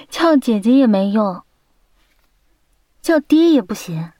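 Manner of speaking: emphatic, insistent turn-down; playful firm refusal. Acted. Girlfriend refusing to help boyfriend yet again, playfully firm. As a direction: dig your heels in, punching the refusals.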